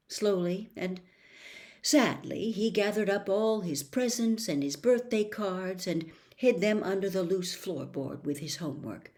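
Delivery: usual speaking voice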